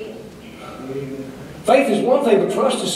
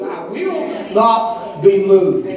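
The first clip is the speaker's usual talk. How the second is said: slow, firm declaration; much slower than usual. Real, not acted. Drops after the shout.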